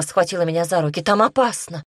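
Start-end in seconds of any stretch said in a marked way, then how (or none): 1.0-1.8 higher-pitched urgent warning; alarmed warning. A narrator's reading. Mother grabs her daughter's hands, warning in alarm. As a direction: warn her with rising alarm.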